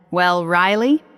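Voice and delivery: plain reading voice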